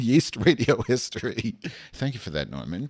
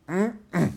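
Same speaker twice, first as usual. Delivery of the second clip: much higher mock grunting hums; Jerry Blank sounds; 10 semitones higher than usual. Host imitates Jerry Blank from the TV show, high mock hums. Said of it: "I'm making my Jerry Blank sounds".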